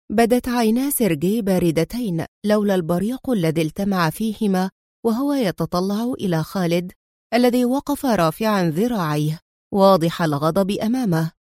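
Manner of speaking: ordinary delivery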